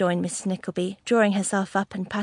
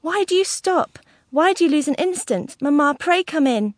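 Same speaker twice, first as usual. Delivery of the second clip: high, urgent alarm; frightened daughter urging mother indoors; terrified pleading; 6 semitones higher, 5 dB louder than usual. A narrator's reading. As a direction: plead anxiously in a raised pitch. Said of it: "really terrified for the moment".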